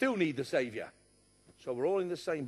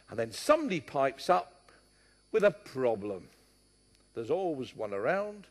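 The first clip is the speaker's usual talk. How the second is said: ordinary talk, nothing marked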